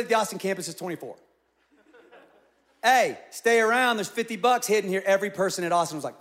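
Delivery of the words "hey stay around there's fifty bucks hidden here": calling out. Retold or imitated. Church announcing hidden prize.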